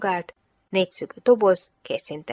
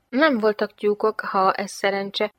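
plain reading voice

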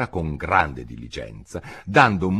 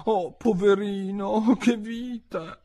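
high, slow sympathy; high-pitched, lingering commiseration; pitying Alice; slower than usual. A narrator's reading. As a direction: draw it out with girlish pity. Alice pities the pigeon.

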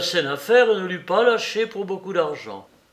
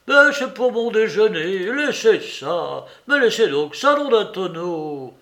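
high, loud pleading objection; flustered protest; 5 semitones higher, 6 dB louder than usual. A narrator's reading. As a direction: beg him to leave it, exasperated. Old man protests at meddling.

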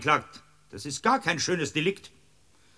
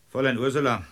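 ordinary talk

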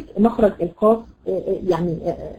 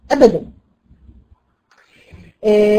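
usual speaking voice

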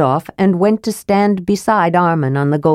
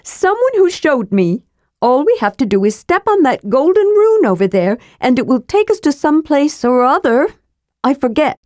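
higher, eager kender voice; sly kender explaining the way to the rune; Tass the kender; 8 semitones higher than usual. Acted. Higher playful kender voice.

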